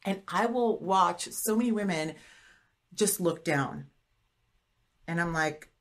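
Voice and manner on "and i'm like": ordinary talk, nothing marked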